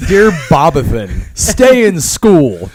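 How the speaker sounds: loud, slow, laughing mock dedication; hammed-up cheesy advice through laughter; corny autograph, laughing. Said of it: "riffs a corny autograph".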